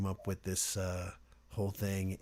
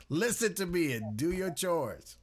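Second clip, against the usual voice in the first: put-on bossy child voice; 8 semitones higher, 4 dB louder than usual. Quoting the bossy seven-year-old.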